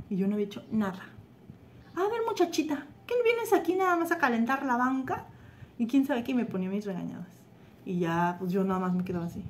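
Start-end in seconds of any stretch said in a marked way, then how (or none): 2.0-5.3 imitated scolding in a raised pitch; grumpy teacher. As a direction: scold the child like a cross teacher. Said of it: "me tocó una maestra bien enojona". (regaño agudo de maestra enojona, imitado)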